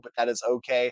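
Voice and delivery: ordinary talk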